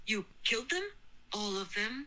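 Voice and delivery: stunned, halting disbelief; shocked disbelief. A narrator's reading. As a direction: ask in hushed shock, rising. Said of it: "stopped and her eyes widened".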